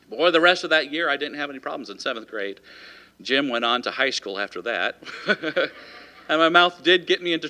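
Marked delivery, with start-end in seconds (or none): none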